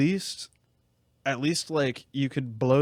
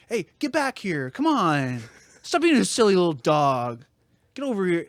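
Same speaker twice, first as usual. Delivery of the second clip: mock dog owner; 3 semitones higher than usual. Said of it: "they're gonna be able to be like".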